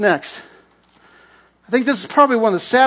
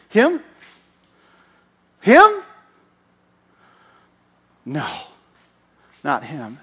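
jealous grumble. Acted. Saul jealous, muttering.

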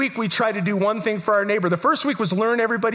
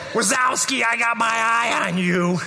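slow, raspy Roz impression of the old neighbor; Roz from Monsters Inc; 2 semitones higher than usual. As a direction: pastor mimics his neighbor as Roz, drawn out. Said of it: "That's exactly how she talked".

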